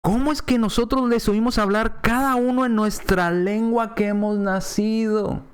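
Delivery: wondering quote, held high; acted amazement. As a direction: read it as astonished onlookers. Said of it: "se quedaron atónitos y maravillados".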